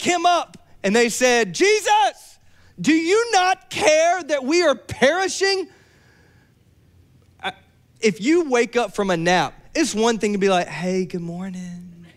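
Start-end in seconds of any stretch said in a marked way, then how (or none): 1.5-5.7 much higher, slow frantic cry; panicked plea. Retold or imitated. Disciples waking Jesus in panic. 10.7-12.1 soft, slow good morning; gentle wake-up. Shown as a demonstration. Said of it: "You wanna be sweet".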